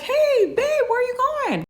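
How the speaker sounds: high, breezy mock husband voice; sweet-talking husband. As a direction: toss it off innocently, like nothing happened. Ex-husband's casual call to her.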